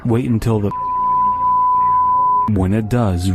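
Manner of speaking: ordinary delivery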